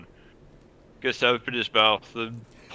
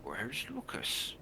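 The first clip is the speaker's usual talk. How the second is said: slow, hushed question; very quiet; 4 semitones higher, 11 dB quieter, slower than usual. Acted. Father asks where the missing boy is, very quietly. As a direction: ask it softly and slowly. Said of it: "Says very quietly".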